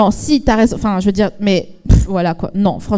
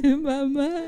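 much softer, breathy repeat; soft take; 3 semitones higher, 9 dB quieter than usual. Acted. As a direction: say it again almost hushed.

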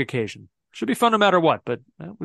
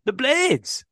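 "The Blades" with a higher, put-on Yorkshire accent; 8 semitones higher than usual. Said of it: "that's your Yorkshire accent".

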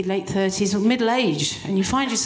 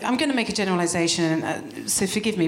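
usual speaking voice

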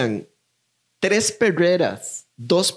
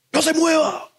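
police shout; 13 semitones higher than usual. Retold or imitated. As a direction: bark the order like a startled cop.

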